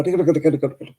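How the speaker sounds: rapid-fire Irish babble imitation; mock fast talk. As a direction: rattle it off without stopping for breath. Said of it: "They don't stop for a breath".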